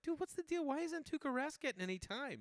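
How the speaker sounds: high, loud demand for answers; incredulous questioning. Retold or imitated. Fan asking Burr about Tuukka Rask, loudly incredulous.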